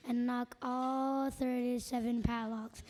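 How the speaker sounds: ordinary delivery, nothing marked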